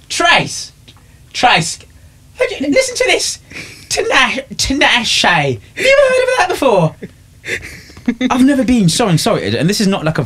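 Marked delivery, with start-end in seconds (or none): 0.1-7.0 high-pitched mock calling out to a colleague; imitated shop worker. Worker calls Trace over his name, high mock astonishment. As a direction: imitate an amazed server, pitched way up. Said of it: "and she went".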